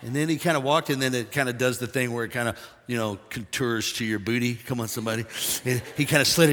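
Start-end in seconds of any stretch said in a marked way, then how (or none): none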